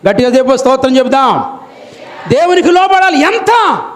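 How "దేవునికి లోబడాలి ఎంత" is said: 1 fervent preaching. Acted. Preaching at full force.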